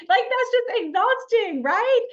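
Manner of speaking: high, loud mock exhaustion; exasperated. Real, not acted. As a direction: sigh it out as if worn out by the idea. Mocking the picture-perfect life.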